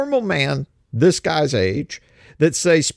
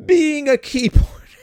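mocking read; 12 semitones higher, 3 dB louder, slower than usual.

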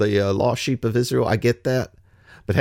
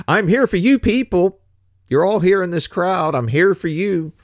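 louder and higher, calling out to the whole crowd; a preacher voicing Jesus, raised and ringing to the crowd; proclaiming to crowd; 6 semitones higher, 4 dB louder than usual. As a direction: announce it over the heads of the people.

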